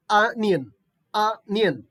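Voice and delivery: teacher demonstrating onion as "anion"; onion with a short a. Shown as a demonstration. Teacher models onion for students, said "anion". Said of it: "We have to pronounce as".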